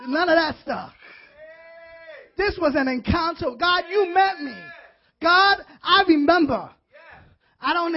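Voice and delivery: loud, high, impassioned sermon; full-force preaching. Real, not acted. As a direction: cry out to God with full conviction.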